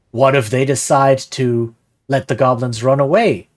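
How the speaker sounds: ordinary talk, nothing marked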